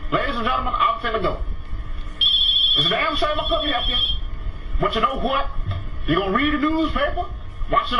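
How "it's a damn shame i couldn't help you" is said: ordinary delivery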